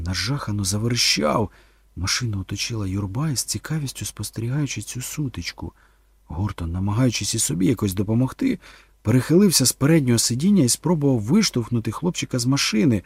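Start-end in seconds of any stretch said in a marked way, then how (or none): none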